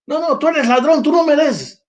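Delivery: high scornful sneer at a thief; mocking judgmental crowd. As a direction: mimic the smug crowd shutting him out. Mocking those rejecting Zacchaeus.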